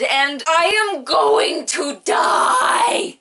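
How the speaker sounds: slow, drawn-out crying wail; hammed-up wail. Real, not acted. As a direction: wail like you can't go on. Fan despair at finale.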